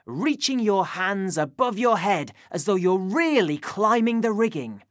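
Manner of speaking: ordinary talk, nothing marked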